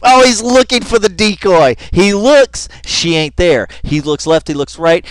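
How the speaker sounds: loud, high-pitched running commentary; excited commentary. Real, not acted. Host narrating the man hunting for the decoy, loud excitement. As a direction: call each move loudly and excitedly.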